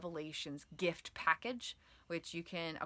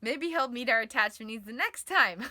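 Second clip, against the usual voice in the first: laughing, higher, louder excuse-making; giddy self-talk; 5 semitones higher, 9 dB louder than usual. Acted. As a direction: coax yourself with a laugh. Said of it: "attached stress response might be over there".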